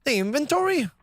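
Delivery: high-pitched Irish accent attempt. Trying how an Irish person would say it, Irish accent. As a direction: put on a lilting Irish voice. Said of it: "how would an Irish person say".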